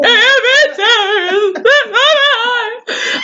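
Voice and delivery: high, slow, wailing sob through the words; tearful protest to the nurse, drawn-out wail; waily crying. Retold or imitated. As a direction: wail it out like you're trying not to cry. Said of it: "came out in a waily thing".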